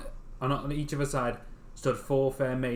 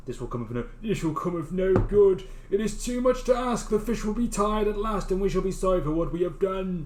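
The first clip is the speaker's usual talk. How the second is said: worried fisherman voice; 6 semitones higher, 4 dB louder than usual. Acted.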